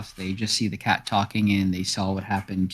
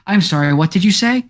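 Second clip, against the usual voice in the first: distracted cat; 9 semitones higher, 7 dB louder than usual. Cat interrupts itself, distracted.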